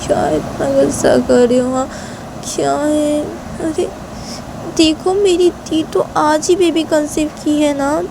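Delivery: high, slowed coaxing voice; acted wheedling explanation; pleading. A narrator's reading. Shanaya pleads her sister's case.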